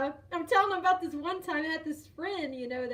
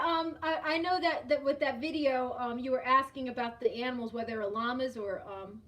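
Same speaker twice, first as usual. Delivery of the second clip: ordinary talk, nothing marked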